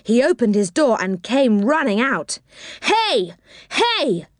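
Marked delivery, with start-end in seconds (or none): 2.8-4.3 high angry calling out; angry cry. A narrator's reading. Little man chasing Rick, crying out angrily. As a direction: shout after the thief, much higher. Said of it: "he cried angrily".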